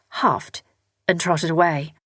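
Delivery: usual speaking voice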